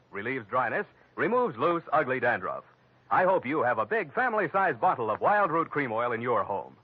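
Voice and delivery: normal talking voice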